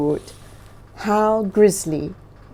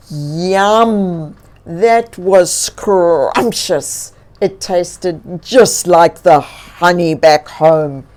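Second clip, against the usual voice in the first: slow, louder, lightly voiced bear; satisfied bear; 2 semitones lower, 7 dB louder, slower than usual. A narrator's reading. Bear telling bird about the honey, slow and satisfied. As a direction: savor every word like a bear. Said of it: "he said".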